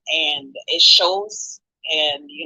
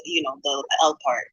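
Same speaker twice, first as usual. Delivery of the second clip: usual speaking voice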